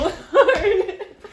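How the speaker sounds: high giggle breaking off; embarrassed laugh. Real, not acted. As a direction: laugh it off mid-song. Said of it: "I can't do it".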